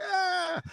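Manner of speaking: high, drawn-out "aah" of exasperation; mock wail of frustration; frustrated cry. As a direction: let out a high wail as if the voice has gone wrong. Mimes frustration at wrong accent.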